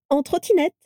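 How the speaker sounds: high-pitched, delighted answer; radiant delight. A narrator's reading. Vera repeating her means of travel, delighted. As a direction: answer brightly, as if obvious. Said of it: "déclara-t-elle rayonnante".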